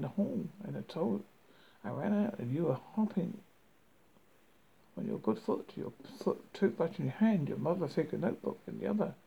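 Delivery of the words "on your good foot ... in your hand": usual speaking voice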